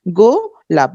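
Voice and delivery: গোলাপ in two parts, "go... lap"; split into syllables. Shown as a demonstration. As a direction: pause after the first syllable of গোলাপ. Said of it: "দুই ভাগে ভাঙতে পারছি".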